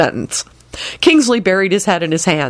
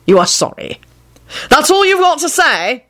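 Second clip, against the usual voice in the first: indignant, rising disbelief; outraged disbelief; 10 semitones higher than usual. A narrator's reading. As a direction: pitch leaps up in outrage. Kingsley outraged at Lupin.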